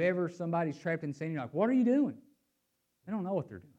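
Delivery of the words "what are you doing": high, loud, acted disbelief; incredulous challenge. Preacher to somebody trapped in sin, incredulous challenge. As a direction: call out the question in mock alarm.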